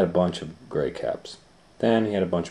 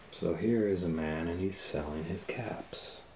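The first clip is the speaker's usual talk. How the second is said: whisper; 9 dB quieter than usual. Real, not acted. Aside while showing book pictures.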